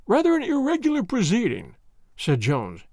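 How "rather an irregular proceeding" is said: doubtful inspector. A narrator's reading. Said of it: "shaking his head".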